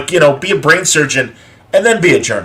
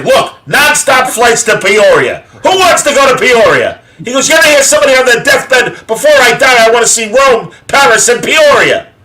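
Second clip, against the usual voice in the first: Alan King bit; 10 semitones higher, 5 dB louder than usual. Retold or imitated. Retelling a favourite comedian's airline joke, loud high comic rant. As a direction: do the old comedian's airline bit at full blast. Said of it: "Alan King used to do ... airline bits".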